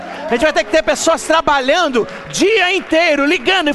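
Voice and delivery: high, loud fired-up exhortation; rally the crowd at full force; fast, loud, high revival preaching. Acted.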